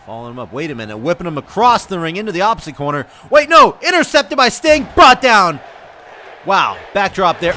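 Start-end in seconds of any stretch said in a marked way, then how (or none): 3.3-5.6 louder, higher burst of surprise; excited play-by-play. Real, not acted. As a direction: call the sudden turn with a jolt. Live wrestling call, sudden interception.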